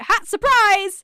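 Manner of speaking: loud, slow, taunting "surprise"; mocking gotcha. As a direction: taunt it slowly and loudly. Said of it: "she's like".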